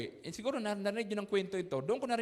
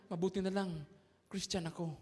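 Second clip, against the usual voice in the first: softer, lower, holding back anger; restrained mutter; 5 semitones lower, 7 dB quieter than usual. Retold or imitated. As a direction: swallow the anger quietly. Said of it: "sabi niya".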